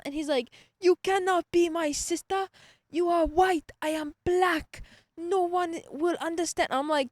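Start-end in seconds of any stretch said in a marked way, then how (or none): 0.8-6.7 high, loud imitation African accent. Student retelling professor's objection to being siblings, African accent. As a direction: mimic a professor's thick, vaguely African accent, emphatic. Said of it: "I don't know where his accent was from".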